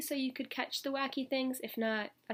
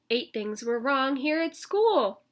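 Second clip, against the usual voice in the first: yelled; 4 semitones higher, 9 dB louder, slower than usual. Acted. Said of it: "I yelled".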